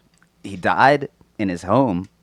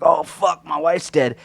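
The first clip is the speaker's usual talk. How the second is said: mock confused old man; 11 semitones higher, 3 dB louder than usual. Imagined Gene finding his wife.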